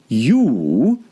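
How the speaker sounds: scornful single-word scoff; contemptuous. A narrator's reading. Caterpillar scoffing at Alice, contemptuous. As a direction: sneer it down at her. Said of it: "said the caterpillar contemptuously".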